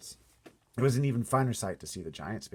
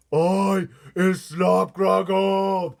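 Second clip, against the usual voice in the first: much higher, much louder, slow squawking giant; giant's squawk; 10 semitones higher, 15 dB louder, much slower than usual. Acted. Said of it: "squawked the gizzard gulper".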